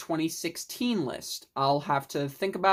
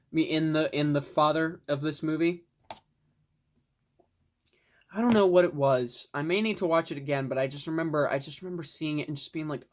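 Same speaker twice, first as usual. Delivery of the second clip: ordinary talk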